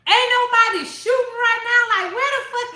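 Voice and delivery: shrill-free high, loud exasperated cry; high, loud cry of disbelief at the book; high mock outrage. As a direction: yell at the page in disbelief. Yelling at book character.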